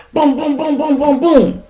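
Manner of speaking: high, loud boom boom imitation; gunshot sounds. Narrator imitating the woman firing, high loud booms. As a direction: mimic the shots with your voice. Return gunfire.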